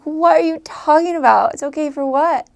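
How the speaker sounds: loud, high mock bewilderment; re-enacted baffled protest; confused. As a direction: question it in exaggerated confusion. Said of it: "it always confused me".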